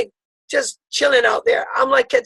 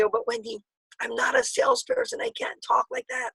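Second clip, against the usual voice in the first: timid prospect; 10 semitones higher, 6 dB quieter than usual. Retold or imitated.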